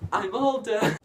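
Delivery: put-on high, shouted little-kid protest; loud, piping cartoon teacup; Chip. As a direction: imitate an eager child yelling out. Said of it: "Chip, the little teacup, is like".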